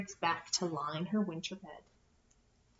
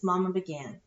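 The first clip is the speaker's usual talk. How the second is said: ordinary delivery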